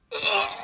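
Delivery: sharp high cry of pain; high pained scream. A narrator's reading. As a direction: cry out as he is stabbed. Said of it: "Pucci screamed".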